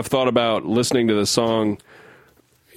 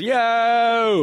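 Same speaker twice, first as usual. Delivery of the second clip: high, long-held rock cry; imitated singer's yell; 9 semitones higher than usual. Host echoes the singer after the line, mock rock yell. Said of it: "He goes".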